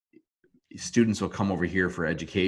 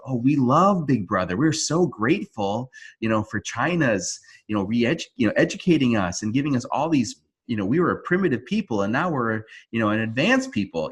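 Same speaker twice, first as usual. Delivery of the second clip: higher, mock-grateful gushing; sarcastic praise; 5 semitones higher, 3 dB louder than usual. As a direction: lay the fake gratitude on thick. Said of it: "like, talking like this".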